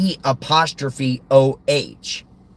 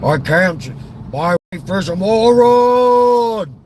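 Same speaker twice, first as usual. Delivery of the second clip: Walter Matthau. Acted. Griping about his wife the moron, exasperated actor impression. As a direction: complain like an exasperated comic grouch. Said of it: "impression of Walter Matthau".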